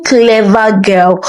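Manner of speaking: ordinary delivery, nothing marked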